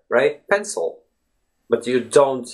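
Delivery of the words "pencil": "pencil" as if no vowel sat between s and l; higher, softer "pencil", second vowel all but gone; vowel nearly dropped. Shown as a demonstration. Teacher imagining the letter isn't there, "pencil" with vanishing vowel. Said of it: "imagine that the letter A is not even there".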